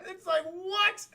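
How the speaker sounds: high, drawn-out disbelief; baffled, high-pitched exclamation; incredulous. Real, not acted. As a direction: squeal it slowly in amazement after laughing. Incredulous reaction after laughing.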